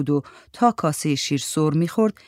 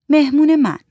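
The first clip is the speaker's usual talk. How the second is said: Mandana's voice; 10 semitones higher, 7 dB louder than usual. Acted. Mandana offers to pay.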